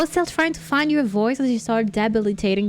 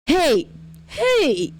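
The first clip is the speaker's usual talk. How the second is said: high, challenging call; improvised high old-lady holler; attempted old lady; 7 semitones higher than usual. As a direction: try an old lady calling someone out. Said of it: "how to make an old lady voice".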